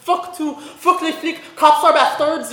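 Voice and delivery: high, loud, slow protest yell; screamed protest. Retold or imitated. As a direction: shout it at the cops at a march. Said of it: "you screamed".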